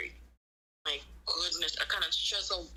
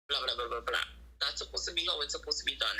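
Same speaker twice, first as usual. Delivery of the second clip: ordinary talk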